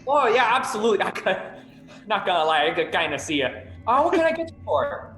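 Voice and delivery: lightly voiced chipper Midwestern host; a hint of a bright Midwestern host. GM voices food court host.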